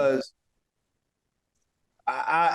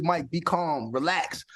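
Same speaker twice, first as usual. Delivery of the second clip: softer, low self-talk; self-calming; 4 semitones lower, 5 dB quieter, slower than usual. Retold or imitated. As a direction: talk yourself down quietly. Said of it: "talk to myself".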